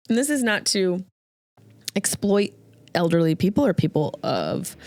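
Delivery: ordinary talk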